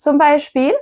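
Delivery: normal talking voice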